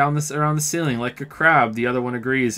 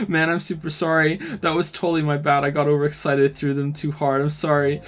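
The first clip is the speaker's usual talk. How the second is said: normal talking voice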